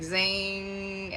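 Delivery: long, held "zing" sound effect; sustained zinging noise; imitated zing. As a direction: stretch zing out on one steady note. Imitates attention being yanked away.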